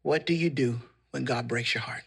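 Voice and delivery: low, softened, measured sermon close; hushed preaching. Real, not acted. Preacher comforting the heartbroken, hushed preaching.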